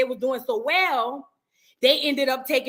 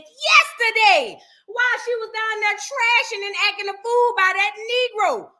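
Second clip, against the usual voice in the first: incredulous; 8 semitones higher, 3 dB louder than usual. Real, not acted. Incredulous gossip retelling.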